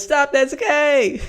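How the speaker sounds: hammed-up parent yelling at his son; put-on shouting voice. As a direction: bellow it like a dad in a put-on voice. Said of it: "in my voice".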